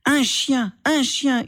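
cri aigu et répété; hurlement. Acted. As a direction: hurle « chien » de plus en plus haut. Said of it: "hurla Asma". (Asma hurle à son frère, cri aigu)

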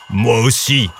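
growled dragon voice; rumbling dragon. A narrator's reading. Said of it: "gronda une voix tout près".

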